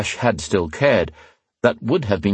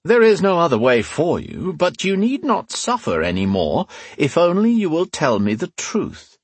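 calm persuading inquisitor; 10 semitones higher than usual. A narrator's reading. Redeemer leader coaxes the prisoner.

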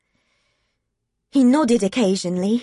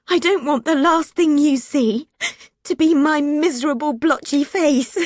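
tearful protest; 5 semitones higher than usual. Acted. Clark sobbing, protesting.

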